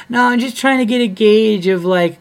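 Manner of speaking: high, loud imitation of an audience woman; mocked heckler. Audience woman excusing her age question, high mocking voice. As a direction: mimic the heckler far above your own pitch.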